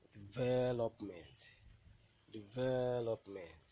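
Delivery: Development with VEL loud and stretched; teacher's demo of de-VEL-opment; stressed syllable. Shown as a demonstration. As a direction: slowly lean hard on VEL in Development. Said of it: "the one that will be pronounced louder".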